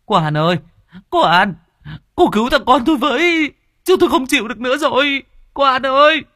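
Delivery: a terrified woman shrieking for the medium; panicked mother crying out for her son, high and loud; panicked calling. A narrator's reading. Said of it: "hốt hoảng ý éo gọi".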